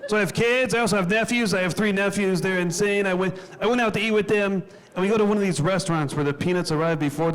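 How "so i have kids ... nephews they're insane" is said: ordinary talk, nothing marked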